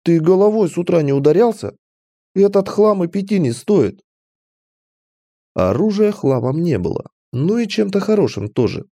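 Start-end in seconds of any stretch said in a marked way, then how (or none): 0.0-4.0 high-pitched, scornful outrage; indignant. A narrator's reading. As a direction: scold the merchant for overpricing junk. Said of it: "возмутилась девушка". (возмущённо, на повышенных тонах)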